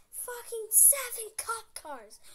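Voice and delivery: high, slow, shocked shout; excited shout. Real, not acted. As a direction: yell it out in disbelief. Shocked at police outside.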